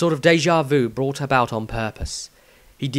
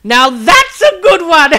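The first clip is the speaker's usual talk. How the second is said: much higher, loud laughing scoff; dismissive laugh; 11 dB louder than usual. Acted. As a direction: laugh it off as a joke. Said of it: "Maestro laughed dismissively".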